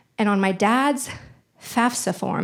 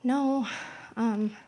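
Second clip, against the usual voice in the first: reluctant sad mom; 3 semitones higher, 7 dB quieter than usual. Retold or imitated. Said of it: "she looked very reluctant and sad".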